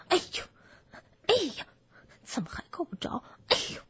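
short effortful yelps between jumps; straining piglet. Acted. Piglet jumps for the door knocker, straining. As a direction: puff out little grunts of effort.

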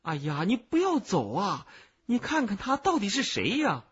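high, anxious plea; urgent shouting. A narrator's reading. Cicada to the boy walking away, urgent shouting. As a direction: call out urgently to stop someone leaving. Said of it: "急得福天儿对我大叫".

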